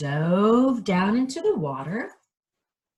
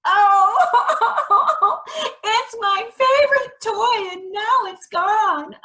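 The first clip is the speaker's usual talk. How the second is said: high, loud mock crying princess; hammed-up wail over a lost toy; fake crying; 14 semitones higher, 6 dB louder than usual. Princess wailing over her lost ball, hammed-up and loud. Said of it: "she started to cry".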